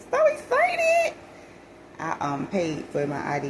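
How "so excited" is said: excited. Real, not acted. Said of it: "I was so excited".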